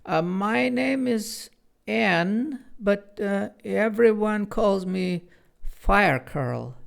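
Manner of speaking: lightly voiced young girl. A narrator's reading. Anne's character voice.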